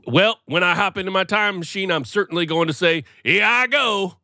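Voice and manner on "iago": high, loud mock battle cry; exclaimed catchphrase. Time traveler hopping into his machine, exclaiming. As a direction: call it out high, drawing out the end.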